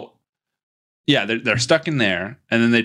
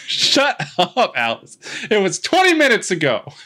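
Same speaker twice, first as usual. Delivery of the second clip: playful mock scolding; 10 semitones higher, 3 dB louder than usual. Real, not acted. Teasing the cat or friend.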